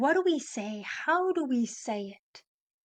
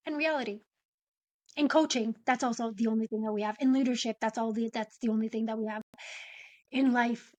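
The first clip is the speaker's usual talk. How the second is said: ordinary talk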